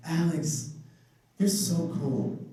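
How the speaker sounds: mild mock flattery at normal pace; a hint of the sweet-talking recruiter; lightly voiced recruiter. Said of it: "she said something like".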